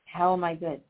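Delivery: ordinary talk, nothing marked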